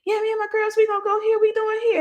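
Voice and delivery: high imitation of the friend; friend's voice. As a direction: voice the friend inviting her out. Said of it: "She was like".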